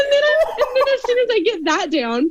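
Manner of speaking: high voice, real laughter in the words; talking through laughter. Real, not acted. Actor telling her audition story, laughing through the words. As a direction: push the story out while still laughing. Still laughing at own accent.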